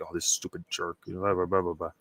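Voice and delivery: low, quiet grumbling, lightly acted; understated irritation, under the voice; retold pissed-off grumble. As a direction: imitate someone grousing to himself. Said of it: "like, pissed off".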